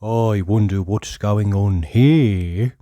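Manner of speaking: drawn-out curious musing to himself; curious Mister Nosey. A narrator's reading. Mister Nosey wondering at a closed door, slow and curious.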